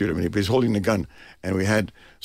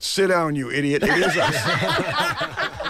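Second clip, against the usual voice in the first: raised, drawn-out mock heckle; retold heckle; 6 semitones higher, much slower than usual. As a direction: call out from the cinema seats, half-acted. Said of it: "another voice cried out".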